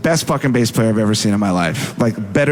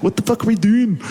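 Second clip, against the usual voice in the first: higher-pitched imitated Scottish voice; Scottish guitar tech; 8 semitones higher, faster than usual. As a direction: put on a Scottish accent, baffled. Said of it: "who's Scottish".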